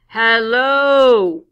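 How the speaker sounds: loud distant call. Acted. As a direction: call out to the car from afar. Hitchhiker calling again.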